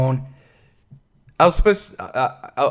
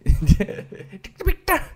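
high giggle; 14 semitones higher than usual. Real, not acted. Laughing at own impression.

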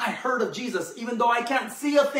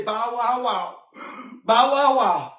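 mock dog bark; 4 dB louder than usual. Woman accepts being called a dog.

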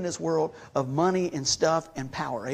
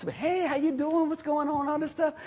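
chirpy cashier greeting; 9 semitones higher than usual. Retold or imitated. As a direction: sing out a friendly hello like a cashier.

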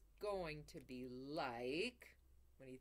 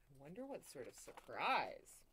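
plain reading voice

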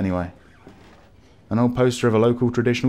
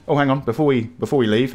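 ordinary talk, nothing marked

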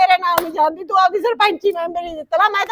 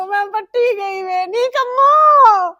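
keen for the dead mother, calling out; loud sobbing wail to her sister; mourning wail; 3 semitones higher, 3 dB louder than usual. Acted.

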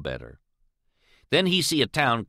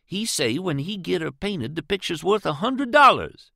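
put-on higher, earnest old servant voice; Jim's voice; 8 semitones higher than usual. A narrator's reading. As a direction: tell it like a proud old family servant. Jim's voice in the story.

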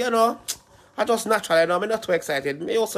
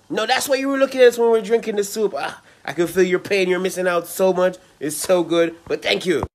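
ordinary talk, nothing marked

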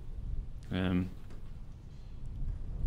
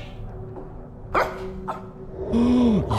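sharp frightened gasp; scared gasp; 12 semitones higher than usual. Real, not acted. Frightened by something in game.

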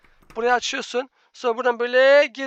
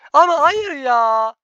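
slow, loud drawn-out whine; a long fed-up moan at the teammate; exasperated groan; 3 semitones higher, 8 dB louder, much slower than usual. Real, not acted. Teammate fails again.

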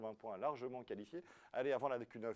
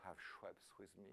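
soft, slow seductive English line; lightly voiced seductive actress; sultry actress; 3 semitones lower, 14 dB quieter, slower than usual. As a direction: breathe it like a film star in an ad. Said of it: "cette belle actrice qui jouait".

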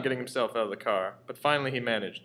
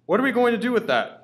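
alarmed; 10 semitones higher, 5 dB louder than usual. A narrator's reading. Mother alarmed question.

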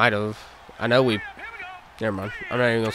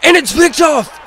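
high, loud sportscaster yell; thrilled, pitched-up play-by-play; excited interception shout; 10 semitones higher, 6 dB louder than usual. Real, not acted. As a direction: shout the pick as it happens. Calling an interception live.

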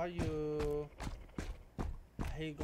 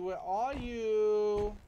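slower, higher, loud call; drawn-out call; 5 semitones higher, 8 dB louder, much slower than usual. Acted. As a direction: stretch the call out. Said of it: "repeated call".

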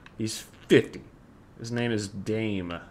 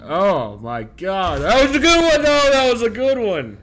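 startled scream; 10 semitones higher, 11 dB louder than usual. Real, not acted. Jump scare, screaming reaction.

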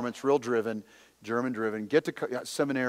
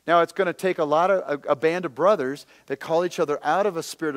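loud, emphatic sermon delivery; preaching at full force; 5 semitones higher, 7 dB louder than usual. Acted. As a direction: drive every point home to the crowd. Preaching to congregation.

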